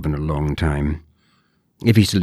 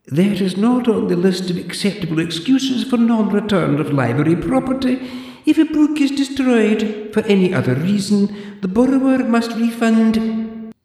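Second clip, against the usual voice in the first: put-on high, prim old librarian voice; haughty zombie librarian, clipped and proper; librarian Deirdre; 5 dB louder than usual. Deirdre lectures the borrower in a high prim voice.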